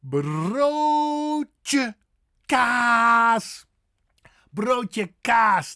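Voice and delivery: high, loud broodje kaas with stretched vowels; exaggerated Dutch broodje kaas; say-along demo. Shown as a demonstration. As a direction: lead learners through broodje kaas. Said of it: "Say it with me".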